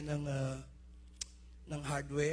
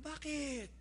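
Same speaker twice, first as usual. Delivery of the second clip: surprised why; 9 semitones higher, 5 dB quieter than usual. Retold or imitated. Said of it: "Sabi ko".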